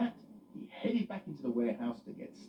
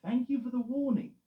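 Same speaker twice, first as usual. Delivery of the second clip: Eddie grateful; 13 semitones higher, 6 dB louder than usual. Acted. Eddie voiced.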